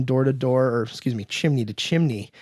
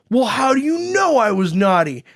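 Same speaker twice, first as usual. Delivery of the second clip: high, loud mock kid voice; high hammed-up protest; indignant kid; 7 semitones higher, 4 dB louder than usual. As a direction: imitate a child demanding to know, pitched up. Bully kid demands an answer.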